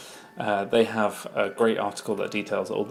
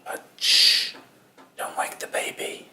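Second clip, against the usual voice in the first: hushed whisper, voice barely there; whispering over a crib. Real, not acted. Shushing, baby asleep nearby.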